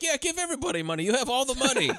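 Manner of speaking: high, fast sarcastic jab; mocking high voice. Mocking Luke Cage's advice.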